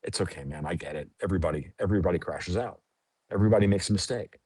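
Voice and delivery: soft, low and gentle, forgiving a friend; quiet, easygoing comfort, no blame; reassuring friend. Retold or imitated. Telling a friend who messed up it's fine, softly and kindly. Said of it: "sometimes you go".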